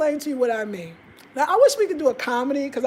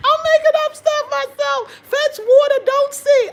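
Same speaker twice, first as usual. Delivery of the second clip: helpless high, loud laughter through the words; squealing with laughter while talking; laughing; 9 dB louder than usual. Real, not acted. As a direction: crack up at your own nonsense. Cracking up at own made-up proverb.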